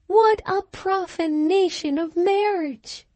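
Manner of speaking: high-pitched, scandalized outcry; shocked exclamation. A narrator's reading. Virtuous friend appalled by the marriage, high shocked exclamation. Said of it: "she exclaimed".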